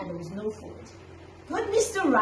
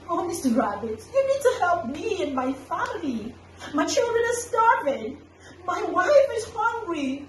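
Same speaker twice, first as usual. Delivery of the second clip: higher, desperate plea, half laughing; pleading Tortoise; 5 semitones higher than usual. Acted. Tortoise begging Rabbit to save his family, high and pleading. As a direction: beg for help like a starving father.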